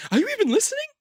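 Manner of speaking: high, exasperated movie-star voice; Mark Wahlberg. Retold or imitated. Acting out the film for friend, high exasperated impression. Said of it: "you do a good Mark Wahlberg".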